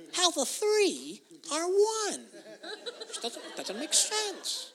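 high, put-on voice of puzzlement; mock baffled questioner. Preacher imitating a member puzzling over the triune, mock baffled. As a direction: stretch it high in hammed-up bewilderment. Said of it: "I get caught up on biblical questions".

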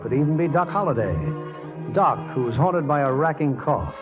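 ordinary talk, nothing marked

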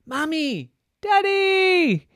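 put-on high, loud child's yell; urgent call for rescue; crying out. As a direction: shout for parents, stretched out and climbing. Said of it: "he cried".

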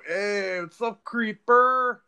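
high, loud, stretched mock Latin greeting; Latin accent. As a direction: holler it slowly with a put-on Latin accent. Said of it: "I wanted to hear someone go".

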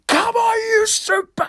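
very high, full-throated chant shout. Retold or imitated. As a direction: throw your whole body into the chant. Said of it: "E urlo ancora più forte".

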